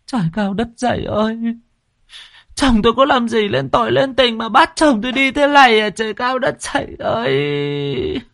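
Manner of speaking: keening high cry with long-held words; plaintive wailing. A narrator's reading. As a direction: wail to heaven in bitter grief. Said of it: "Tiếng của thím Kích vang lên ai oán".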